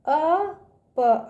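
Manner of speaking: ordinary talk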